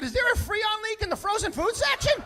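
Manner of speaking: high, loud, laughing sarcastic jab; mock him loudly with a laugh; sarcastic yell at the overgeared shopper; mocking shout.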